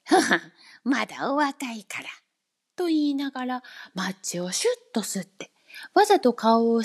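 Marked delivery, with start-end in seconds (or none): 0.0-2.1 amused chuckle. A narrator's reading. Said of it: "山猫は笑って".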